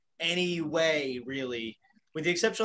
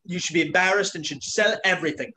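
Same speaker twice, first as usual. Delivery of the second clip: a quick, lightly faked British judge accent; hammed-up harsh talent-show judge, barely put on; lightly voiced snooty British critic; mock Simon Cowell; 3 dB louder than usual. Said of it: "I'm not gonna be like Simon Cowell in American Idol".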